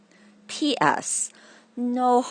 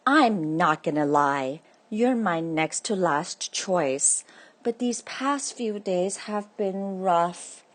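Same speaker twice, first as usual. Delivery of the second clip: ordinary delivery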